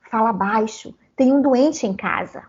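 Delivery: ordinary delivery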